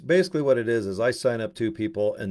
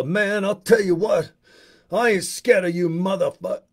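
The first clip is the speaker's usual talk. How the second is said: high, loud, brash Bernie Mac bravado; imitating a comic daring the crowd; Bernie Mac impression; 9 semitones higher, 6 dB louder than usual. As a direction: belt it out like a fearless stand-up comic strutting on stage. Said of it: "he'd talk like this".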